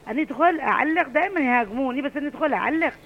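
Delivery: ordinary talk, nothing marked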